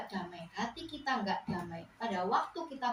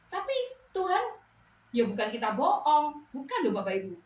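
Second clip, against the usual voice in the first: emphatic; 5 semitones higher, 8 dB louder than usual. Real, not acted. Preacher insists, not lying.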